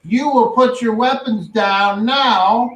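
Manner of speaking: commanding sheriff. Acted. Sheriff orders weapons down.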